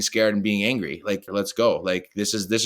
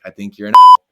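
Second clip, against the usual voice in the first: much louder playful insult; joking jab; 15 dB louder, faster than usual. Retold or imitated. Player ribbing his coach in office, loud joking jab. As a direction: blurt the jab then break into laughter. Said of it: "And I'm like".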